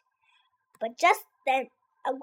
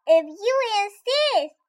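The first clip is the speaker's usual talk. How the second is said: sparrow; 6 semitones higher than usual. A narrator's reading. Sparrow replies.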